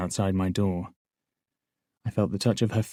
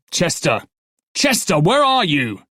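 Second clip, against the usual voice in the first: loud high bellow for a partner; guard yelling for his absent partner, bellowing; 9 semitones higher, 7 dB louder than usual. Acted. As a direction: bellow down the corridor. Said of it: "the guard on duty bellowed".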